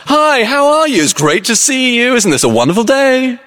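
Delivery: very high, hammed-up jolly cheer; over-jolly greeting. Yellow-suited mourner greeting a funeral crowd, wildly over-jolly. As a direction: gush like the life of the party. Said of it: "going about it is so loud".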